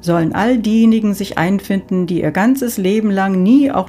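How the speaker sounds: Saint Peter announcing. Acted. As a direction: direct the souls like a gatekeeper.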